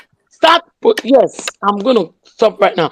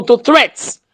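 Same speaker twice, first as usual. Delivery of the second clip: ordinary talk, nothing marked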